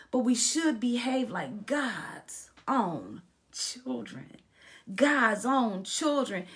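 rousing pulpit delivery; emphatic preaching. Acted. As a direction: preach the verse, stretching key words. Preaches the verse again.

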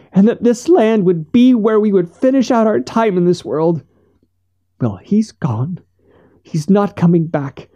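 high-pitched voice of a wronged widow; grieving, defiant Missus Finch. A narrator's reading. Missus Finch speaking of her lost husband, grieving defiance. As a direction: plead high, building to defiance.

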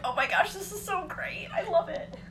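high, breathless thrilled gasp of joy; breathless kid delight. Retold or imitated. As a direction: gasp at the best present ever. Child seeing gift under tree.

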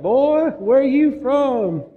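high, slow, exaggerated Southern drawl; mock Southern accent. Tennessee local quizzing the Yankee salesman in a mock Southern accent. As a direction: stretch it out as a hammed-up Southern local. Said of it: "that was a terrible accent".

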